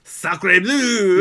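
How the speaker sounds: put-on loud, high French-accented exclamation; mock French outcry. Reacting to a Versailles break-in, mock French outcry. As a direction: cry out in hammed-up French.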